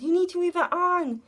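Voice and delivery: high, insistent nurse voice; firm nurse. Retold or imitated. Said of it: "and she said".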